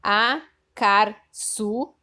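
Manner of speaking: very slow and loud, each syllable of acarçu drawn out; teacher dictating the scrambled word acarçu very slowly; slow syllable reading. Shown as a demonstration. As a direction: sound out acarçu syllable by syllable for kids. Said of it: "Eu vou ler do jeito que está no livro".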